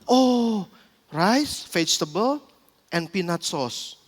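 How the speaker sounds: soft, low, slowing musing; thinking it over. Acted. Flight attendant puzzling over a passenger's order, soft and thoughtful. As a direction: repeat it slowly and quietly while working it out. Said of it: "si pramugarinya mikir langsung".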